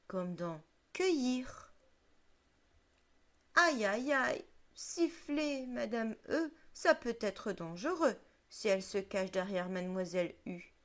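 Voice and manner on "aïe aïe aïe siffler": ouch cry. Acted. Madame E cries out in pain.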